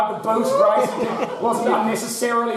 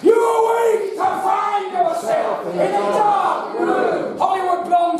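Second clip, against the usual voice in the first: dramatic announcer; 11 semitones higher, slower than usual. Acted. Host sets the scene for the player in a grand announcer voice. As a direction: boom the scene out slowly. Host's dramatic game narration.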